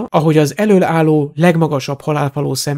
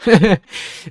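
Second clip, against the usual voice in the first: short derisive snort of laughter; mocking snort; 4 semitones higher than usual. A narrator's reading. Masked follower answering Borgin's denial, mocking snort. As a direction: snort with scornful amusement. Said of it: "Gúnyos horkantás volt a válasz".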